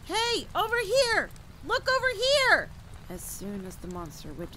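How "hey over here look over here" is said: drawn-out, high, loud yell; shouting. A narrator's reading. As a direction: holler slowly to lure it over. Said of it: "I shouted".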